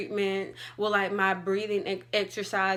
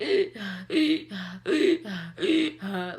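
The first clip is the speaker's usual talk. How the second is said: sharp gasping breaths; loud gasps; 4 dB louder than usual. Shown as a demonstration. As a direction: gulp air loudly. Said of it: "in between words".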